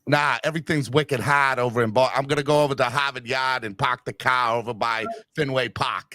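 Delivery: low, hammed-up Boston accent. Host playing up a low Boston accent after being teased. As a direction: lay on thick Boston, dropping the R's. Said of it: "You sound like you're from Boston".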